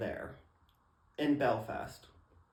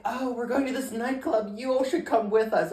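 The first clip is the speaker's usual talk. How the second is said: higher, louder, eager voice; upbeat pitched-up invite; excited invitation; 8 semitones higher, 5 dB louder than usual. Retold or imitated. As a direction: invite them brightly, pitched up. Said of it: "they're like".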